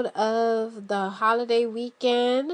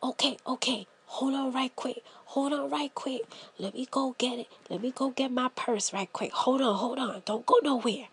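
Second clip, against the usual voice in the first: hushed hurry; 2 semitones lower, 10 dB quieter, faster than usual. Retold or imitated. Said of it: "And I'm like".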